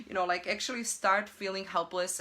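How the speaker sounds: ordinary delivery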